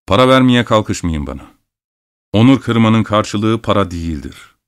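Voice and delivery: usual speaking voice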